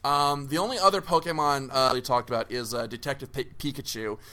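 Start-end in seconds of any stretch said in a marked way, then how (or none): none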